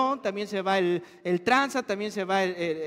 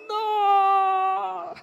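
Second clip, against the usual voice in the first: mock tearful protest; 10 semitones higher, 4 dB louder than usual. Parent fighting tears at school.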